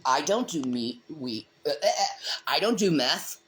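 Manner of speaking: ordinary talk, nothing marked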